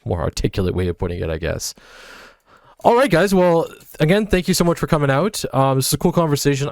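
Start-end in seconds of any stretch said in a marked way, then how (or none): 2.8-6.7 upbeat sign-off. Real, not acted. Host starts the sign-off.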